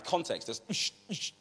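ordinary talk

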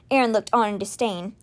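usual speaking voice

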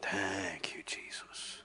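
soft whisper of thanks; hushed demo of praise. Shown as a demonstration. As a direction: breathe the thanks to Jesus. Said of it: "this is how Jesus did it".

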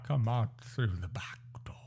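whispered warning in a put-on accent; urgent stage whisper. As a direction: whisper it like a conspirator to his lord. Acts servant warning his sire.

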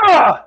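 sharp, high exasperated yelp of a frustrated dad. Retold or imitated. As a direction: let out a loud, high burst of frustration. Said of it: "I'm sitting there going".

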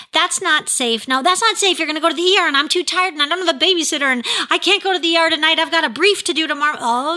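fast, high-pitched run-on excuses; anxious over-explaining. Acted. Frazzled parent over-explaining to a small child, fast and rambling. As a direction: rattle off excuse after excuse in a rush. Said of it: "If you're talking really fast and I".

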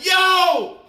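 high, punchy shouted hail; retold yell. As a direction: holler it out as if across the lot. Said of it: "I'm yelling".

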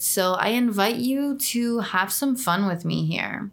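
ordinary delivery

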